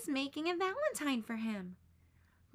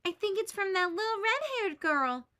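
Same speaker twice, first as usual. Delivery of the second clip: higher and louder, bursting with hope; excited; 8 semitones higher, 5 dB louder than usual. A narrator's reading. Boy tells his friend the letter is from his crush, excited. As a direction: read it like he can't believe his luck. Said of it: "says excitedly".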